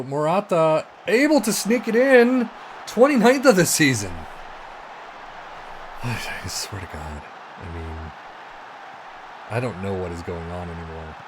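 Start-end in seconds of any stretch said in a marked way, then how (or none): 4.2-6.0 deep unvoiced sigh of defeat; heavy weary sigh; long exasperated sigh. Real, not acted. Exasperated after conceding. 6.0-11.2 defeated mutter. Real, not acted. Frustrated after conceding goal.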